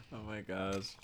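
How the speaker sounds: ordinary delivery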